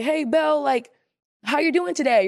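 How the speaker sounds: high, bright sing-song hello; lightly voiced villager; cheerful villager greeting. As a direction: greet her like a friendly townsperson. Voicing villagers greeting Belle.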